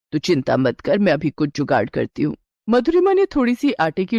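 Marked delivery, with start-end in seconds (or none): 0.1-2.4 a lightly lowered, steady mother-in-law. Acted. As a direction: reassure the bride as a knowing elder. Mother-in-law calms the bride. (हल्की सी नीची आवाज़ में सास)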